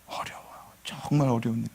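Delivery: hushed emphasis; whispered. Real, not acted. Pastor whispering how hard it is. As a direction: drop to a whisper.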